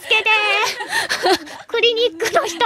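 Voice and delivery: high, loud comic cries for help; playful shrieking plea for rescue; mock panic. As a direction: wail it like a hammed-up emergency. Mock panic over skin spots.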